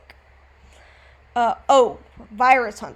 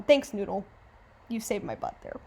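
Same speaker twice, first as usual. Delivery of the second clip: ordinary delivery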